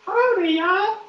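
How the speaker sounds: very high, squeaky Southern howdy; put-on y'all accent. Prank caller trying out an accent, high-pitched mock Southern. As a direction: try a silly falsetto y'all accent. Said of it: "I should talk with an accent".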